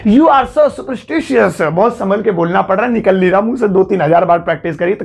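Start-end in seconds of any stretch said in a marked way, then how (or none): 0.0-1.6 very slow, laughing through it; slow laughing demo. Shown as a demonstration. Teacher laughing while modelling a hard word to students, very slowly. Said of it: "इसे English में कह सकते हैं".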